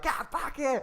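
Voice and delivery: playful imitation of a furious shout, high; mock yell. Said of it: "a teacher just screaming at the top of their lungs".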